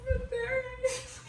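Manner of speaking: tearful protest. Acted. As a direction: whimper it, still upset from crying. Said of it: "Colin's sobbing screams".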